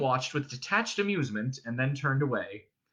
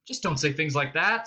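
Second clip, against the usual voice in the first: plain reading voice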